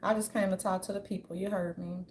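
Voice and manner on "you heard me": ordinary talk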